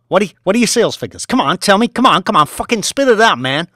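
fast, loud, high badgering; demanding shout. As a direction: hammer him for an answer. Boss demanding figures.

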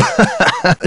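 high-pitched giggle; high laugh. Real, not acted. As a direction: burst into a high little laugh. Laughs at own joke.

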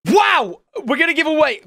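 high, excited outburst; amazed exclamation. Real, not acted. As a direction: burst out in astonishment. Amazed at the diamonds found.

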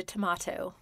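"Tomato" with a British broad "ah" in the middle; British pronunciation. Acted. Naming a Charlie and Lola book, British-style "Tomato". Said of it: "You have to say tomato like that".

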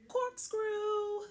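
very high, thrilled call; high excited corkscrew. Acted. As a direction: squeal it out. Truck takes a sharp turn.

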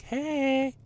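silly high impression of a taunting enemy; mock bandit voice. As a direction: squeak it out like a mocking bandit. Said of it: "but then they're like".

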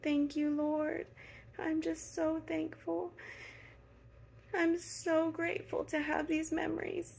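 fighting back tears of gratitude; a real catch in the throat, voice rising and fading; choked up. Real, not acted. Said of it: "I didn't mean to get emotional".

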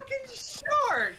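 slow high drawn-out cry; outraged wail. Real, not acted. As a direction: drag it out in outrage. Outrage at shark.

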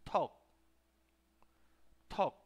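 "Talk" as Korean "teok" with a puff on the T; short, separate "Talk" with airy T; aspirated T. Shown as a demonstration. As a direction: burst the T of "Talk", clip off the k. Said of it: "Así es más o menos como".

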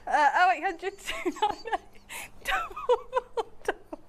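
giggling through a phone number; laughing. Real, not acted. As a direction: read the number while cracking up.

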